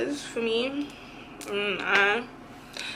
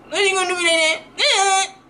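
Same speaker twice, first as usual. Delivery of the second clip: higher, weepy whine; whiny cry; 7 semitones higher than usual. Retold or imitated. Whining back at the cousin who scolds her, voice near tears. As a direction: whimper it at your cousin.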